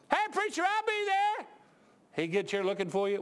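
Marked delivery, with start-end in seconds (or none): 0.1-1.5 high-pitched mock-eager call; high, breezy assurance to the preacher; eager promiser impression. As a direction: imitate a keen promiser, voice way up. Mimics people promising to show up.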